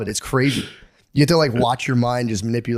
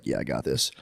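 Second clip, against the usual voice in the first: tender self-talk; 13 semitones lower, 9 dB quieter than usual. Retold or imitated. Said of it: "it's the sweetest joy".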